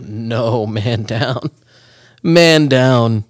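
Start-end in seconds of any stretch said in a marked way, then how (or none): 2.2-3.3 loud, drawn-out call; mock emergency call. Friend reacts to the accidental shroom eater, mock alarm call. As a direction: call it out like a casualty report.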